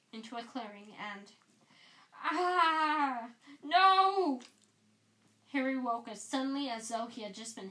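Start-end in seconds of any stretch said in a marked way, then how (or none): 2.2-4.4 sudden loud shout, much higher; startled cry. Acted. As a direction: cry out waking from a nightmare. Waking from nightmare, crying out.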